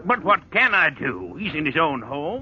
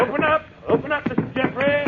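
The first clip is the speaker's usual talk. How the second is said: raised, fast demand at a locked door; calling through the door; 9 semitones higher, faster than usual. Acted. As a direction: bang on the door and call out. Constable pounding at the door.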